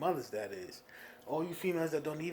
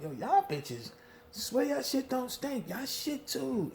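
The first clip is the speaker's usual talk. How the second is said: mocking rant; 9 semitones higher, slower than usual.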